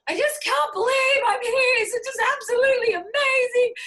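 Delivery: high, loud, almost tearful gushing; retold gushing. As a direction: gush like you can't believe you're there. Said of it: "I'm standing up there going".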